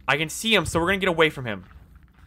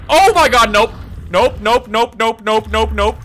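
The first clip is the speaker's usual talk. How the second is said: loud, frightened cries of nope, pitched up; panicked nope; 5 semitones higher, 9 dB louder than usual. Real, not acted. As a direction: yell it in real panic at the monster. Monster appears, player panics.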